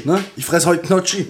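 usual speaking voice

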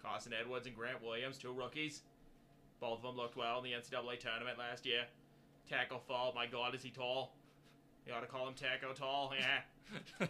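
loud hammed-up Boston accent; Kennedy Boston accent. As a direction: boom it out like a Kennedy. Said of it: "let's do the Kennedys of the Boston Celtics".

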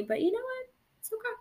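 high, soft forced cheerfulness; sing-song self-reassurance. Real, not acted. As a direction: talk yourself calm, brightly and high. Reassuring herself after the crash.

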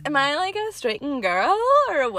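a hint of a girly influencer; straight girl voice. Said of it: "what's my straight girl voice?".

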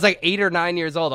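ordinary talk, nothing marked

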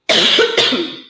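real cough after choking. Real, not acted. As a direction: cough to clear the throat. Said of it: "engasguei aqui, menina".

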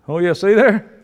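ordinary talk, nothing marked

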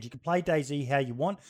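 normal talking voice

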